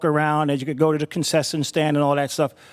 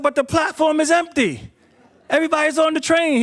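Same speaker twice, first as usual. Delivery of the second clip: much higher, disbelieving protest; incredulous protest; 10 semitones higher than usual. Retold or imitated. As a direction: object in disbelief, voice way up. Narrator protests empty platform.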